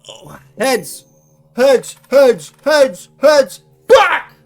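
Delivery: urgent, repeated high shouts ending in a curse; excited shouting. Real, not acted. Player calling heads on a toss, urgent shouting.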